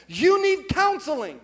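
loud, high, slow mock demand; hammed-up imitation of a wife's order; exaggerated scolding. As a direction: lay it on thick and loud. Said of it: "she didn't say it quite like that".

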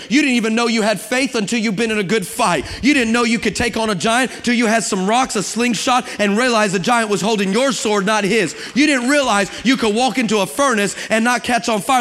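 ordinary talk, nothing marked

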